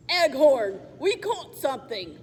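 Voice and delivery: a touch of a low troll growl. Acted. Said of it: "said the low, growling voice".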